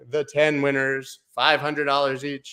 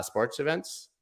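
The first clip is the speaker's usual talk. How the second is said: ordinary talk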